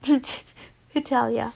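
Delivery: ordinary delivery, nothing marked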